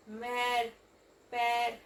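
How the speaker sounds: mère and père said with the open French è sound; accent grave demo. Shown as a demonstration. Teacher modelling the accent grave sound in mère and père. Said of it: "will become a".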